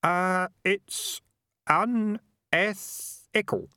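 put-on high, halting boy sounding out "unethical"; careful, broken-up "un-eth-ical"; trying out pronunciation. A narrator's reading. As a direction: say "unethical" slowly in separate syllables. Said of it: "trying out the pronunciation".

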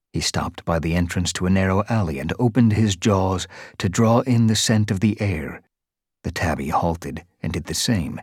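ordinary delivery

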